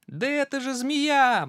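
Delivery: high, loud cry; mouse shouts. Acted. Mouse calling out to the snake, loud and high. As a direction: call out to the snake. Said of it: "мышонок закричал".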